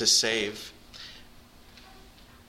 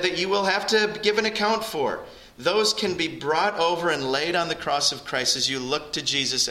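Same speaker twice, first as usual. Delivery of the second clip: raised, ringing sermon voice, pressing the point; fervent preaching; 6 semitones higher than usual. Acted. Pastor calling listeners to Jesus, raised fervent preaching. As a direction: pastor urging the congregation to come to God, preaching fervently. Preaching on God's saving mercy.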